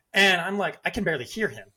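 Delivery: normal talking voice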